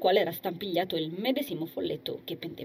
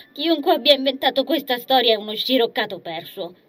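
scoffing; 4 semitones higher, 6 dB louder than usual. Acted. Agnese scoffs, shakes head.

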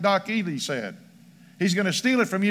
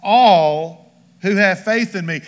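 slow and louder, leaning on "All"; emphatic; 6 dB louder, slower than usual. Shown as a demonstration. Scene: preacher stresses the verse to the congregation, emphatic. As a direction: hit "All" hard, slowly. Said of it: "it's also emphatic".

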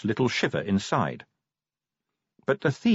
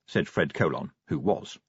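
ordinary delivery, nothing marked

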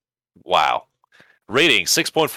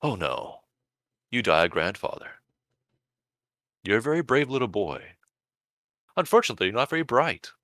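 soft, gentle Death with a smile; a hint of a kindly Death; wistful Death; 3 semitones higher, 5 dB quieter than usual. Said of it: "Death smiles wistfully".

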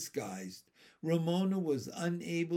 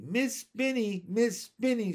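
high, urgent call for help; crying out; 7 semitones higher, 4 dB louder than usual. A narrator's reading. Teased girl runs to her teacher, crying out. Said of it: "she cried".

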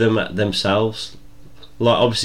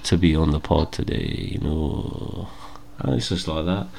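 flat, dull Scottish drone, lower; deadpan Scottish athlete sounding bored while claiming excitement; lightly done Scottish monotone, a bit lower; monotone Scottish Andy Murray; 3 semitones lower, 5 dB quieter than usual. Retold or imitated. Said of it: "monotone, dull, Scottish accent".

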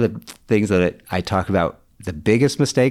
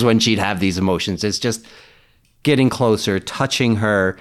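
usual speaking voice

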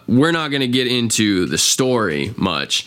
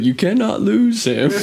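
much higher, louder, breaking into laughter; laughing through quote; 12 semitones higher, 4 dB louder than usual. Retold or imitated. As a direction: quote it while cracking up. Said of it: "You, he's like".

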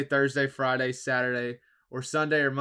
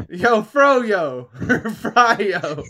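calling out; 6 semitones higher, 10 dB louder, much slower than usual. Real, not acted. Calling to departed friend.

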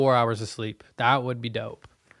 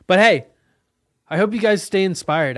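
much higher, louder, bright pep; upbeat encouragement; 8 semitones higher, 9 dB louder than usual. Real, not acted. Wishing his listeners well, loud excited encouragement. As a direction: lift them up with a burst of cheer.

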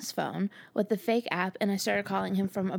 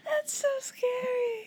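horrified; much slower than usual. Real, not acted. Reacting to creepy prank.

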